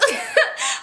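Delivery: high, bursting real laugh; sudden high laugh; burst of laughter. Real, not acted. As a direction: crack up at the absurdity. Laughing while retelling the threat.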